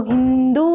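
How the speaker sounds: हिंदू with the oo held out; long u in हिंदू. Shown as a demonstration. Teacher corrects students' spelling, हिंदू with long oo. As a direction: stretch the final oo of हिंदू. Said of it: "बड़ों की मात्रा लगती है".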